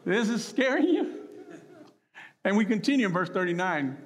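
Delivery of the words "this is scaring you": high-pitched, incredulous, laughing disbelief; the Lord. Acted. Looking up at panicking Peter, amused disbelief. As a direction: tease a scared friend through a laugh. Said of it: "the Lord looking up at him going".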